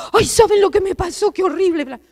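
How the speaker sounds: like a hysterical woman who keeps telling it again; dramatic retelling of a drama. Pretend. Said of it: "si seguimos contando". (en voz dramática y exagerada, como quien cuenta una desgracia)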